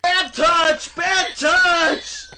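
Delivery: high, loud mock alarm; shouting. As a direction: yell it like a scared kid.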